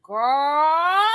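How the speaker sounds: put-on loud drawn-out girl. Retold reaction, loud.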